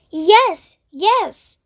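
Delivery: high, eager yes yes; eager chorus. A narrator's reading. Travellers agreeing to go upstairs, eagerly. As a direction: cheer like a whole gang at once. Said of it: "they chorused".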